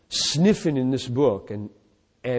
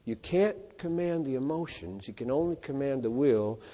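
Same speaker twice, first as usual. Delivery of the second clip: quiet musing; 9 dB quieter than usual. Shown as a demonstration.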